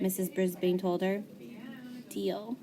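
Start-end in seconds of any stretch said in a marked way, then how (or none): 2.1-2.6 soft little whispered deal. A narrator's reading. Shy girl whispering her promise to the teacher, softly. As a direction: breathe it out quietly. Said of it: "Saya whispered".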